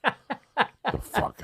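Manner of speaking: high squeak of disbelief, laughing; laughing, baffled outburst; squeaky shocked disbelief; incredulous the fuck. Real, not acted.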